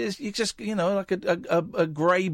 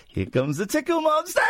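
giggly tickle attack; 11 semitones higher than usual. Pretend. Announces tickle monster.

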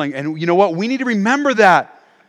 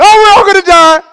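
high, loud mock-panic scream; panicked; 11 semitones higher, 9 dB louder than usual. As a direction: shriek it in hammed-up terror. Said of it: "somebody sneezed and somebody went".

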